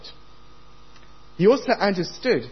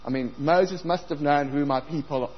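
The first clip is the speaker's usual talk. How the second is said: usual speaking voice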